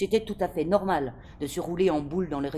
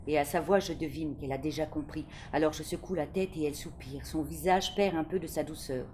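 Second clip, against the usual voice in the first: ordinary delivery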